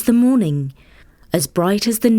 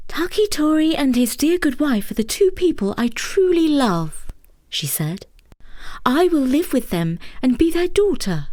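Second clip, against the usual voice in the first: higher, loving maiden voice; tender maiden; 7 semitones higher than usual. Acted. Maiden refusing suitors for her parents, higher and tender. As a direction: speak fondly of them.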